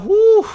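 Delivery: high, loud exhaled whew; mock relieved whew. Recalling relief at the plot twist, high mock whew. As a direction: blow out an exaggerated whew.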